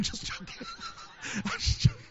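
laughing it off breathily; real laugh, high and airy. Real, not acted. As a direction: giggle through the words. Laughing after his own joke.

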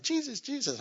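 cricket voice. Pretend. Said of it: "The cricket's going".

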